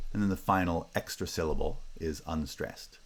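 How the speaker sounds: ordinary delivery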